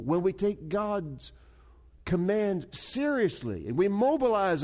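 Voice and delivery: high, loud, slow pulpit exhortation; full-force preaching. Real, not acted. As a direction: build the charge phrase by phrase.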